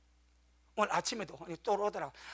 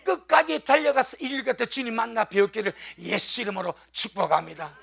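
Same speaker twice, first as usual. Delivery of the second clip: preaching blessing; 3 semitones higher, 7 dB louder than usual. Acted. Pastor blessing his congregation in a loud fervent voice. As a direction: call the blessing out over the church.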